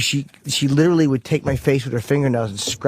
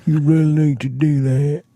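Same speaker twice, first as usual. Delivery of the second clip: put-on character voice with a Southern accent; Eugene, Southern accent; 2 semitones higher than usual. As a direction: play a Southern character preaching at someone. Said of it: "but with a southern accent".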